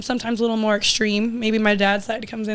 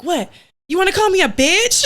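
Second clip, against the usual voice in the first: rising, defiant sass; sassy challenge; 7 semitones higher than usual. Retold or imitated. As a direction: dare her to say it. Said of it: "my mom would be like".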